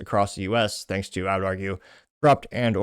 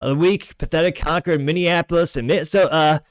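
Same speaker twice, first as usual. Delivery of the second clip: high, loud, hammed-up politician impression; Trump; 9 semitones higher, 7 dB louder than usual. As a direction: rant loudly in a raised presidential caricature. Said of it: "I'm sure if Trump were to".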